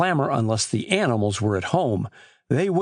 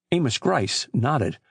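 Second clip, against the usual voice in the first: usual speaking voice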